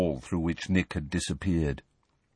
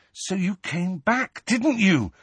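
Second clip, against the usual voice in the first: higher, slow pleading question; urgently; 10 semitones higher, slower than usual. Acted. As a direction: demand it with urgent hope. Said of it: "said Harry urgently".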